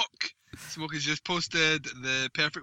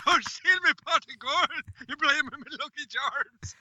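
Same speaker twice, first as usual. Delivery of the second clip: very high Irish leprechaun squeak; 12 semitones higher than usual. Acted. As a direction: shriek like a tiny leprechaun. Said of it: "I'm the Leprechaun".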